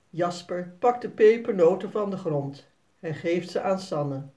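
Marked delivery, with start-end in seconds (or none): none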